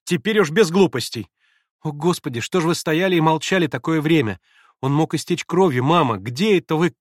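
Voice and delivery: взволнованно и громко; торопить мать в испуге; нервно. Acted. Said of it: "Нервно закончила она". (нервно и громко)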